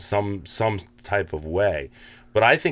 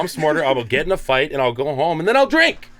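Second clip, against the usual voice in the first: defiant rant; 7 semitones higher than usual. Retold or imitated. Acting out his defiant attitude.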